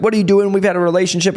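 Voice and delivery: a rep scolding a doctor for trialing competitors, with a mock yell. Imitating an upset rep yelling. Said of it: "They actually yell at doctors".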